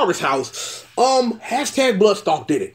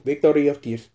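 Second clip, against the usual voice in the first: ordinary delivery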